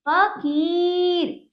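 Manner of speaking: फकीर very slow with a long ई; drawn out, joined. Shown as a demonstration. Teacher practises reading whole words, drawn out. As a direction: stretch the की in फकीर. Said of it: "जोड़कर पढ़ने का प्रयास".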